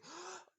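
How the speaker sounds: sharp high delighted gasp; a quick gasp of discovery; high intake of breath; excited gasp. Acted. Alex learns about the crush.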